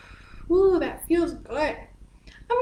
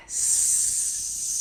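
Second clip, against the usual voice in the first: snake hiss; 11 dB quieter than usual. Shown as a demonstration. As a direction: breathe out in a long steady hiss. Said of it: "And we breathe out".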